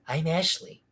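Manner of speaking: soft little character catchphrase; Ashley. Acted. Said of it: "a character that he just says one thing".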